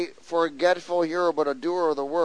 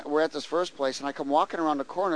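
ordinary delivery

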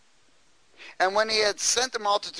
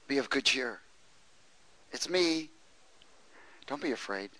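soft, quiet comforting voice; calm them down softly; gentle reassurance; 3 semitones lower, 7 dB quieter than usual. Retold or imitated. Jesus to his frightened disciples in the storm, soft and reassuring. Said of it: "that's what he says".

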